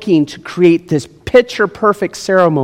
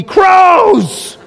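high, loud alarmed cry; sudden exasperated shout; startled shout; 4 dB louder than usual. Pretend. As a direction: yell out at the crows breaking in. Abram interrupted by crows.

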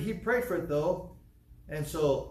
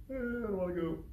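put-on higher, softer childish whine; small boy refusing the haircut; whiny little kid; 7 semitones higher, 5 dB quieter than usual. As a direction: whimper like a scared toddler.

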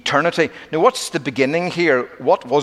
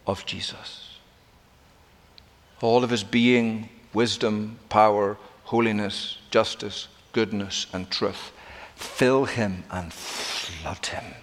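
hushed awe; 5 semitones lower, 7 dB quieter than usual. Acted. Hushed devotional climax.

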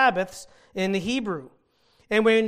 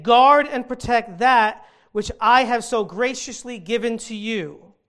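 commanding God; 3 semitones higher, 7 dB louder than usual. Acted. Preacher voices God's command.

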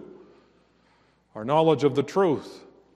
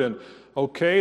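ordinary talk

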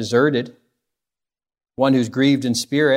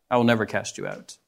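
softer, lower repeat of the promise; soft echo; 4 semitones lower, 6 dB quieter than usual. Acted. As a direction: let it fall away gently.

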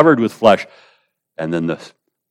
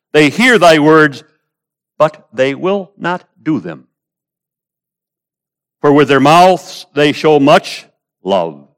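ringing pulpit reading; declaimed scripture; 7 semitones higher, 6 dB louder than usual. Acted. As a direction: proclaim the verse with a raised, measured voice.

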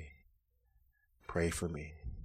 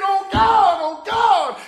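loud, high, begging "Oh, God"; a desperate shouted altar plea; pleading cry to God; 16 dB louder, much slower than usual. Acted. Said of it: "you plead".

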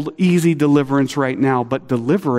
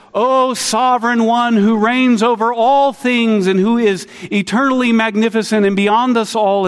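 grand formal prayer; 8 semitones higher, 3 dB louder than usual. Retold or imitated. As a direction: intone it like a pompous prayer. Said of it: "pray like this".

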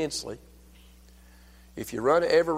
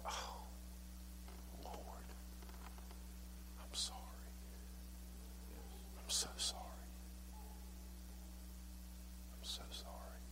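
barely audible whisper; hushed, penitent whisper; whispered repentance; 24 dB quieter than usual. Acted. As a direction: whisper it as a remorseful plea to God. Acting out a repentant prayer.

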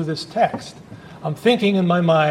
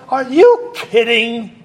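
incredulous; 5 semitones higher, 5 dB louder, slower than usual. Retold or imitated. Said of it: "it's kinda like".

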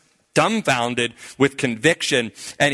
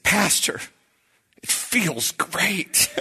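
high, slow, choked-up delight, acted; overcome joy, retold; 7 semitones higher, much slower than usual. New convert telling his pastor how it feels, overcome. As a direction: draw it out, imitating overwhelmed joy.

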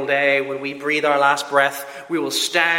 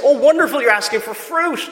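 bright, much higher delighted parent voice; 7 semitones higher than usual. Pretend. Parent to child asking for fruit, high delighted gush.

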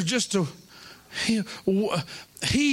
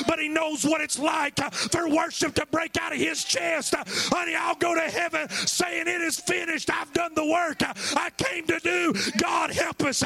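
full-force preaching; 6 semitones higher than usual. Acted. Preacher to the congregation, full-force shouting sermon climax. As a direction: preach it to the rafters, rising and hammering.

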